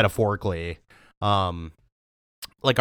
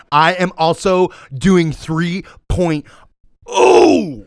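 excited shout; 6 semitones higher, 7 dB louder, much slower than usual. Real, not acted. Matching the joke rating.

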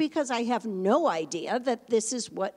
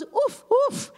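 put-on very high, louder oof oof like a proud little girl; toddler's oof oof; 7 dB louder than usual. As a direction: squeak out the child's dog bark. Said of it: "so she goes".

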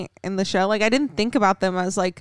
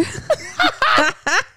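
squeaky embarrassed giggle; high giggle. Real, not acted. Laugh after admitting a burp.

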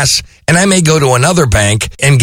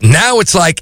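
ordinary talk, nothing marked

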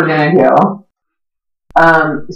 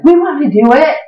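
eager cousins; 10 semitones higher, faster than usual. Retold or imitated.

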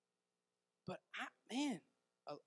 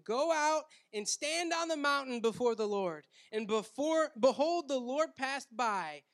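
preaching scripture reading; 4 semitones higher, 6 dB louder than usual. Acted. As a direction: proclaim the verse to the congregation. Reads scripture at full force.

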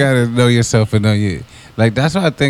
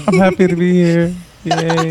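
high, sing-song mocking echo; 9 semitones higher than usual. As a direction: tease by parroting back. Mimics S3's line.

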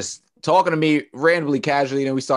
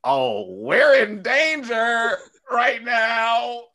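high, very drawn-out mock wail; mock panic; 10 semitones higher, 4 dB louder, much slower than usual. Imitating the girl who felt unprotected, exaggerated alarm. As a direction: stretch every word, pitched up, hammed-up alarm. Mocking how she felt.

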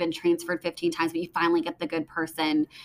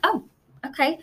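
mock surprise; 8 semitones higher than usual. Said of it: "I'm like".

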